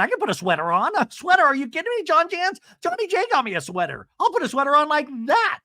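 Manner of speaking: high-pitched gushing imitation; mock giddy excitement. Mock excited quote of woman.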